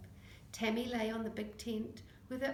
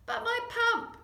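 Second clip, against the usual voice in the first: loud, much higher anguished cry; high dismayed outcry; 13 semitones higher, 8 dB louder than usual. Acted. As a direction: wail it in dismay. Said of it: "cried Vinny".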